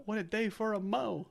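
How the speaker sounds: high put-on voice; quoted movie line. Quoting the My Blue Heaven line in a put-on voice. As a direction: do the line like the character.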